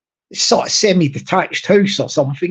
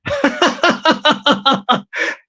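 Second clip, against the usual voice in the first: laugh; 4 semitones higher than usual. Real, not acted. Laughs at own sung apology.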